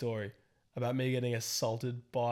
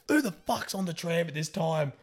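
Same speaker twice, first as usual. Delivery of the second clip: raised, higher-pitched complaint; mock whinge; 6 semitones higher, 4 dB louder than usual. Passenger moaning about the late tram, mock whinge. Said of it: "she's having a whinge".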